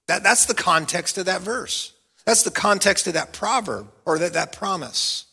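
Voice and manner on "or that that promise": ordinary delivery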